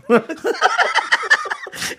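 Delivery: high burst of laughing; real laughter. Real, not acted. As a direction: crack up at the answer. Laughing after awkward answer.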